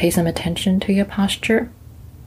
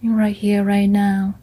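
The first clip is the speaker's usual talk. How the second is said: gentle meditation guide; guided meditation. Acted. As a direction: ease them into calm, one body part at a time.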